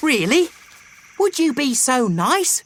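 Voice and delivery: high, delighted weasel mum; light up with relief; grateful Mother Weasel. A narrator's reading.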